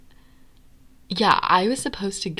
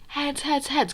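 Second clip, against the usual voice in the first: imitating a guy yelling a warning, pitched up; mock warning yell; 8 semitones higher than usual. Guy throwing the rock warns the group: high mock yell. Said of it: "he's screaming".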